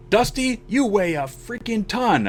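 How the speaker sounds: high, slow mock complaint; straining teammate's complaint. Big teammate carrying the stretcher complains, retold griping. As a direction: imitate griping under a heavy load.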